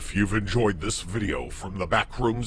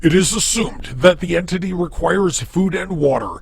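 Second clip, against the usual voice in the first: measured, formal reading of a creepy database file; documentary entry read; 4 semitones higher, 4 dB louder than usual. Acted. Reads a Backrooms database entry.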